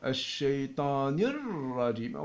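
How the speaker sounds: "Minash shaytanir rajim" recited slowly with linked words; slow recitation. Shown as a demonstration. Teacher showing the reading, "Minash shaytanir rajim" slow and linked.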